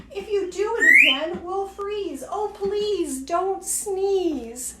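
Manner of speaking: high, slow scared plea; frightened pleading. Acted. Fish begging, afraid.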